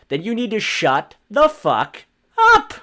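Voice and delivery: rising angry shout; angry rant. Real, not acted. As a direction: slam each word of it. Ranting at Clinton defenders.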